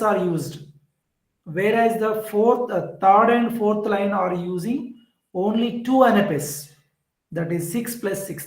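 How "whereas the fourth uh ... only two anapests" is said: ordinary talk, nothing marked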